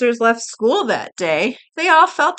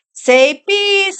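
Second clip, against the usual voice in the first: high, louder photographer's call; pitched-up cue for the camera; photographer's prompt; 8 semitones higher, 5 dB louder than usual. A narrator's reading. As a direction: call it out to the child posing. Photographer calls out to Betty.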